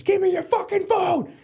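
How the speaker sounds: full-throated, very high furious scream, imitating dad; slow, drawn-out enraged yell in front of the whole school. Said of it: "in front of the whole school screams".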